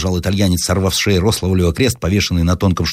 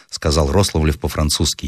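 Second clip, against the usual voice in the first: ordinary talk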